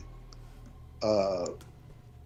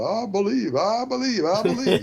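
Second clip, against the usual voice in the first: higher, louder, hammed-up chant of belief; a mocking believer with hands raised; mock fervent believer; 7 semitones higher, 4 dB louder than usual. Mocking a believer, hammed-up chanted I believe. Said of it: "putting your hands up and saying".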